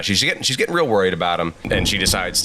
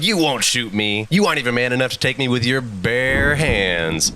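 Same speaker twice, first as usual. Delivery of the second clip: higher-pitched hammed-up dare; acted goading; 5 semitones higher than usual. Trapped Spider-Man daring Kraven to fight, goading. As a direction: dare him, stretching the words.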